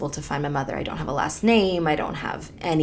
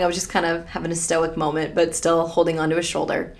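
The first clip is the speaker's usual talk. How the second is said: normal talking voice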